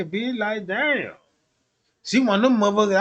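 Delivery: ordinary talk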